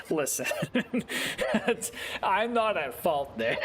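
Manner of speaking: real laughter breaking up the words; laughing protest. Real, not acted. Disowning blame for a friend's setup, laughing.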